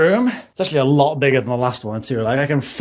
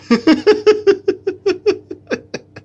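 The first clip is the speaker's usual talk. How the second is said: a sudden high, rising laugh; high burst of laughter; 4 dB louder than usual. Real, not acted. Laughing to viewers at the brownie mess, high and rising. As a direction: crack up at how bad it looks. Brownies look bad on video.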